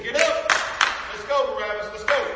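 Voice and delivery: high, loud jailer's command; guard's shouted order. Acted. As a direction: shout the prisoner up and out. Guard shouts orders at condemned prisoner.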